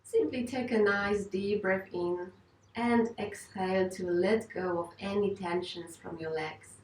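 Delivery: ordinary talk, nothing marked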